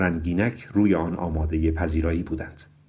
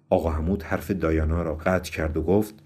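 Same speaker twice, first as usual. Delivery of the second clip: ordinary delivery, nothing marked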